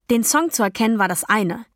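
usual speaking voice

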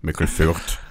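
low put-on voice; disguised deep voice. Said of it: "Ich hab nur meine Stimme verstellt".

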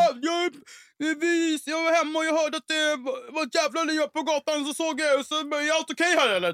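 high, put-on officer voice; imitated self-important policeman; mock hero cop. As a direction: babble it high like a flustered officer. Voices the cop explaining himself.

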